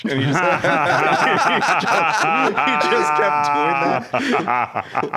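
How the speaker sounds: can't stop laughing at the memory; real laughter breaking into the words. Real, not acted. Laughing while recalling the teasing.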